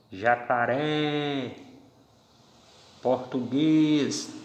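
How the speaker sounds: Jacaré and português drawn out, final syllable stressed; slow stress demo. Shown as a demonstration. Teacher showing the class final-syllable stress. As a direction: stretch each word and land on the last syllable. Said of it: "a tonicidade veio para a última sílaba".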